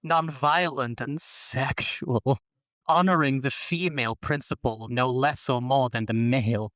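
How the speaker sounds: slow, breathy perfume-ad read; sultry voice. Acted. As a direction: purr it like a perfume commercial. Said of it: "I'm doing the sultry voice for heterosexuality".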